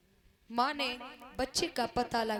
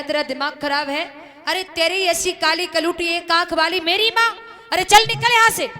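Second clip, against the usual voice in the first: loud, fast contemptuous rant; angry son; 4 semitones higher, 13 dB louder than usual. Acted. Son angrily throwing his mother out, loud and scornful. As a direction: chase the old woman off in anger.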